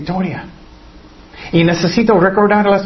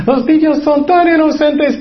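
mock sweetness; 9 semitones higher than usual. Repeats the phrase mockingly.

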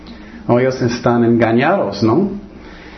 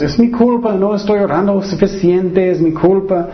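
higher-pitched, mildly fretful confession; anxious self-blame; 7 semitones higher than usual. Acted. Preacher voices a believer who feels at fault, mildly anxious self-blame. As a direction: imitate a believer blaming themselves.

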